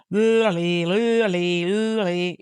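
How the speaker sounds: loose, droning lully with tongue out; voiced noise through a stuck-out tongue and moving lips; tongue-out voice exercise. Shown as a demonstration. Demonstrating a mouth warm-up, tongue-out babble. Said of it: "sticking my tongue out and changing the shape".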